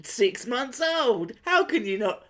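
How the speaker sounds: high-pitched mock indignation; affronted acquaintance. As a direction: act the relative appalled to be forgotten. Mock relative shocked at being forgotten.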